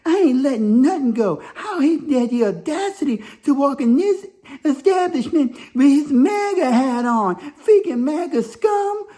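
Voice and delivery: high-pitched mock indignation; imitated outraged woman. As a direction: rant high like a scandalized customer. Retells woman outraged at his hat.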